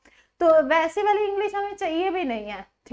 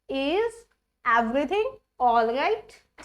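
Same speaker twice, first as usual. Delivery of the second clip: slow demo; much slower than usual. Shown as a demonstration. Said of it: "ठीक है".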